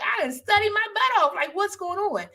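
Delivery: high, loud, exasperated complaint; a retold frustrated cry to God, high and loud; upset outburst. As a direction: vent like you studied for nothing. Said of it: "now I'm really upset".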